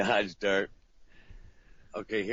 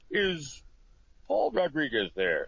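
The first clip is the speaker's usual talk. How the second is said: higher polite caller voice; caller asking for him; 6 semitones higher, slower than usual. Retold or imitated. Comedian imitating a caller asking for Paul Rodriguez. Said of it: "they're like".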